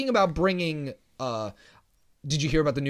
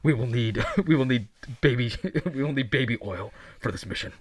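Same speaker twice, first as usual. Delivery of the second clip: hollow talking mask; 6 semitones lower than usual. Pretend. Mock villain voice play.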